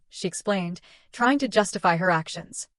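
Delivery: ordinary delivery, nothing marked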